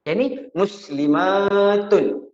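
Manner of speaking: muslimatun drawn out slowly with a falling tune; slow falling model of muslimatun; careful intonation. Shown as a demonstration. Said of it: "Intonasi kita tu".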